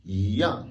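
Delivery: "yan" as yi yang, yi rising then yang falling; second-tone yi. Shown as a demonstration. Teacher shows fourth-tone case, rising yi at start of "yan". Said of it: "le i devient automatiquement deuxième ton".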